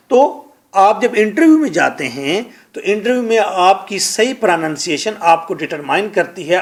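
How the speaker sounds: normal talking voice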